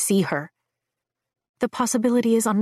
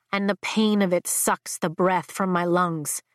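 ordinary delivery, nothing marked

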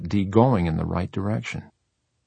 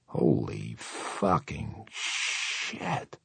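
very slow, hushed and deep; stunned low murmur, word by word; 6 semitones lower, 9 dB quieter, much slower than usual. A narrator's reading. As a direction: drop low and draw each word out softly. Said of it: "Huddy said in a low voice".

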